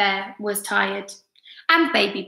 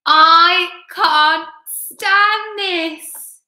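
very slow, sing-song loud wail; high, stretched-out storybook protest; exasperated bear; 8 semitones higher, 7 dB louder, much slower than usual. A narrator's reading. Bear refrain again.

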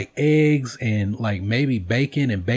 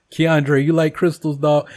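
calling out; 5 semitones higher, 5 dB louder than usual. Real, not acted. Calling out to a viewer.

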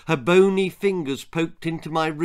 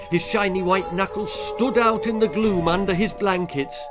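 urgent, raised narrator's voice; tense storytelling; 7 semitones higher, 4 dB louder than usual. A narrator's reading. Telling of a hand at the boy's window, tense. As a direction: build the dread, higher and more urgent. Tale builds to terror.

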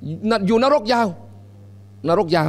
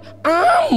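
feeding call; much slower than usual. Retold or imitated. Feeding sound.

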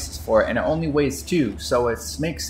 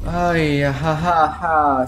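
nervous laugh; 3 semitones higher, 4 dB louder, much slower than usual. Real, not acted. Nervous laugh after the attack.

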